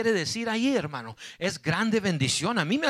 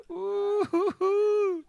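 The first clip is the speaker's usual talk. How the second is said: high drawn-out "uh" whoops; exultant whoop; 11 semitones higher, 4 dB quieter, much slower than usual. Real, not acted. Preacher celebrates Jesus defending him, high exultant whooping. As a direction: let out a long, high whoop.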